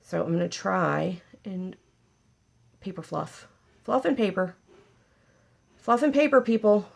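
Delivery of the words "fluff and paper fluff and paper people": higher and louder mock fanfare; hammed-up announcement. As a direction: announce your fluffing like a big feat. Hammed-up proud fluffing announcement.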